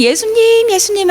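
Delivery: crowd calling Jesus. Acted. Crowd trailing behind Jesus, high calling voice. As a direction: call after him like an eager follower. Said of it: "하면서 많은 사람들이 예수님 뒤를 졸졸졸졸".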